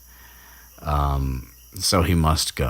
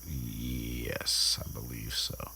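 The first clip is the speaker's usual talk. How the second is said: quiet aside; 5 semitones lower, 13 dB quieter, much slower than usual. Real, not acted. Answers own question aside.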